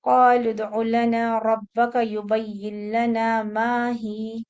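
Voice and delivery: slow, loud Quranic recitation; tajweed recitation. Shown as a demonstration. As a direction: recite the whole verse slowly and clearly. Recites full phrase.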